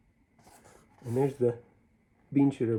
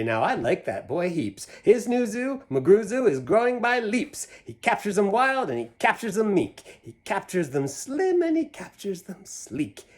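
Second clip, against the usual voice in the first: admiring crowd; 9 semitones higher, 8 dB louder than usual. Retold or imitated. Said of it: "Then people will say".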